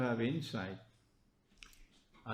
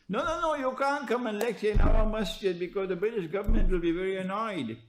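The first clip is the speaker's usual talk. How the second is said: mock mosque official; 7 semitones higher, 6 dB louder than usual. Mocks mosque refusing lecturer.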